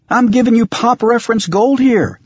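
high, loud exasperated complaint; exasperated shout. Acted. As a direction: shout it in disbelief. Exasperated outburst continues.

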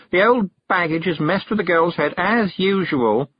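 put-on high-pitched disdainful young witch; complain, dragging out the last words; exasperated. A narrator's reading. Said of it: "rolling her eyes".

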